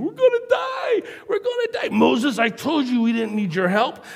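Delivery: high frantic yell, we're doomed; panicked complaining. Retold or imitated. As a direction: shriek it like a terrified crowd. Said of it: "they're all complaining".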